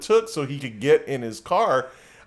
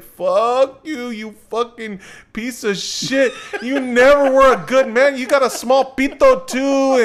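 mock angry girlfriend; 7 semitones higher, 5 dB louder than usual. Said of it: "She's like".

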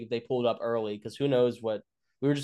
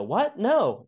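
high, louder mock girlfriend voice; imitated protesting wife; 10 semitones higher, 6 dB louder than usual. Wife overheard answering a cop's questions, protesting. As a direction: pitch it way up as her startled denial.

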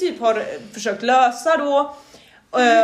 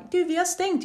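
higher, softer imitation; mock staff voice; 7 semitones higher, 5 dB quieter than usual. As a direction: imitate the clerk lightly. Personal citeras igen. (högre, tystare härmning)

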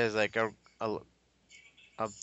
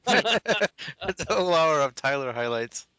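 laughing; 7 semitones higher, 7 dB louder, slower than usual. Real, not acted. Joking through laughter.

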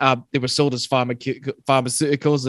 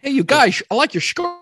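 high sailor voice; 6 dB louder than usual. Retold or imitated. Voicing French sailor.

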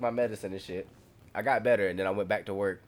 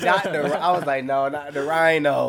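louder, higher, slow mock lament; mock panic; 5 semitones higher, 7 dB louder than usual. Host hamming up his dread of the rhinovirus, loud mock lament. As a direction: plead dramatically with fate.

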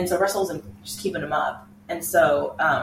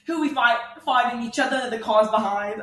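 higher-pitched British accent impression that slips toward Australian; bad British accent; 3 semitones higher than usual. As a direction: badly imitate a feisty British driver. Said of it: "I cannot do a British accent".

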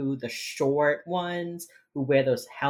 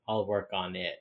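imitating a glum, low-energy colleague; low, soft, downcast reply; depressed; 6 semitones lower, 7 dB quieter than usual. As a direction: agree with mock dejection. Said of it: "So depressed".

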